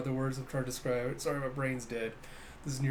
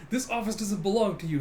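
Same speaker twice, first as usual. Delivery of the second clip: raised-pitch mock scolding; indignant retort; 5 semitones higher, 3 dB louder than usual. Officers quarrelling over the office, higher indignant retort. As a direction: snap it in the flustered arguer's voice. Mistakenly continued in flustered captain's voice.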